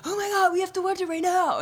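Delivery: put-on high excited surfer-dude voice, Southern California accent. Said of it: "He was like".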